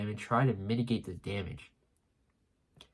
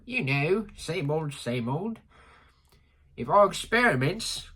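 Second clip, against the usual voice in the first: put-on British accent, higher pitch; 5 semitones higher, slower than usual. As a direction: play it in a mock British voice. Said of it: "Vegapunk has a British accent now".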